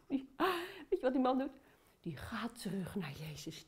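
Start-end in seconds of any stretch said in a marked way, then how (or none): 2.0-3.7 soft, hushed storytelling whisper. A narrator's reading. Storyteller whispers to the children about the grateful man. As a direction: whisper the twist to the kids. Dramatic reveal of the one man.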